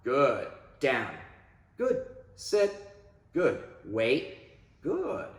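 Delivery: a touch brighter, steady home cues; easy praise and cue; calm inside commands. Shown as a demonstration. Trainer cueing a dog in the house, calmly upbeat. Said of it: "but when I'm inside".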